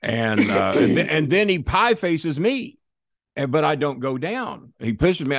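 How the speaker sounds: ordinary talk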